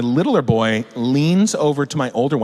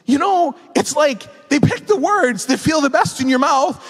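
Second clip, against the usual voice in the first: put-on older boy. Older boy complaining to a parent about swear words, indignant kid voice. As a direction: pipe up like an excited, outraged child. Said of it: "my older boy goes".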